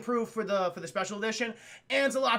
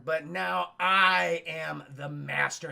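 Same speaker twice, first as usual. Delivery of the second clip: menacing Vader; 4 semitones lower, much slower than usual. Acted.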